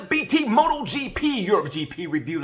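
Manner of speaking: big loud show opener; loud and high, kicking off the show; loud intro. Real, not acted. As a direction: shout the welcome like a host. Energetic show intro shout.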